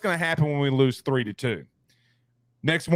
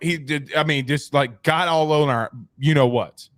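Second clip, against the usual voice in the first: usual speaking voice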